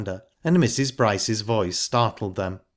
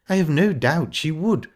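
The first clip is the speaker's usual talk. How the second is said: higher-pitched, lightly sarcastic retort; 7 semitones higher, 4 dB louder than usual. A narrator's reading. Missus Bryce to her stepdaughter about Flora, sarcastically. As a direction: agree with pointed sarcasm. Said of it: "said Missus Bryce sarcastically".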